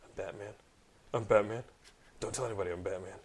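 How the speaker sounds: mock Batman whispering his identity; hushed Batman. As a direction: confide it like a hero's secret. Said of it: "telling somebody his sacred identity".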